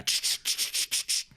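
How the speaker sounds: rapid-fire rattling gun clicks; imitated gun rattle. Podcaster mimicking overused gun sound effects, clicking rattle. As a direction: make the noise of a gun being handled. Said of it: "You're gonna hear a".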